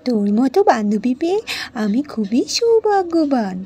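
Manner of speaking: usual speaking voice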